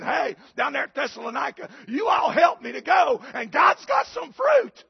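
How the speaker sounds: shouted, climbing high preacher's cry; preaching at full force. Acted. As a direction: build the call up to a soaring shout.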